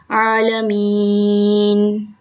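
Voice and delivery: आलमीन with ain pushed from the throat, long ी; slow आलमीन, throaty ain. Shown as a demonstration. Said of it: "इसका वफ़ अदा होगा".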